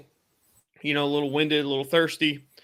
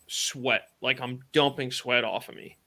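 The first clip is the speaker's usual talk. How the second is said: usual speaking voice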